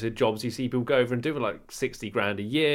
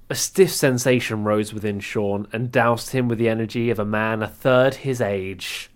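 dramatic storyteller voice; story narration; 4 dB louder than usual. Acted. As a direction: read it like a saucy tale. Reads his comic story.

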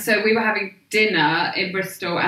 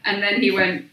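ordinary talk

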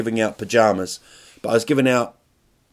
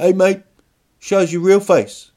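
high, loud, pushy challenge; passive aggressive; 8 semitones higher, 7 dB louder than usual. Acted. Guy confronting the costumed banana, passive aggressive. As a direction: needle him loudly, pitched up. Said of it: "a bit aggressive, passive aggressive".

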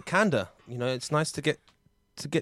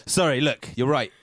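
ordinary talk, nothing marked

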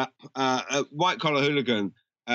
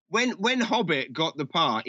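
normal talking voice

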